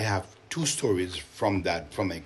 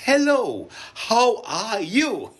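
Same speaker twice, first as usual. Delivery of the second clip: high, loud, slow "Hello, how are you?"; high and slow for the kids; translated greeting; 11 semitones higher, 7 dB louder, slower than usual. Shown as a demonstration. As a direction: spell out the meaning brightly. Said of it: "it means".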